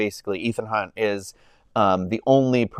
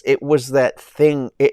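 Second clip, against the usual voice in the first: usual speaking voice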